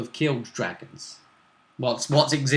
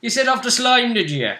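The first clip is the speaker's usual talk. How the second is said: high, loud elf voice, falling at the end; Dalish elf; 9 semitones higher, 4 dB louder than usual. Acted. Elf asking about the slime, high in character. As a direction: call them out in character, starting high. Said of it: "And he was like".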